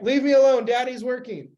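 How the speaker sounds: loud, high mock snap at a pestering child; acted busy dad's outburst; exasperated parent.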